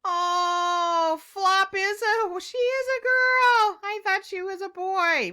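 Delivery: high loud amazed voice; high surprised exclamation. Real, not acted. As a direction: marvel at the discovery. Surprised discovery.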